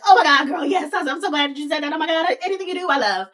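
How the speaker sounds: breakneck fanboy gush; mock overexcited gushing. Bach about to jump out his skin, gushing excitedly. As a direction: burst out, then race through the praise. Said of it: "about to jump out his skin".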